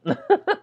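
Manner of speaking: short high laugh; high burst of laughter. Real, not acted. Vlogger laughing at his idea of handling it himself. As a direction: let out a quick high laugh.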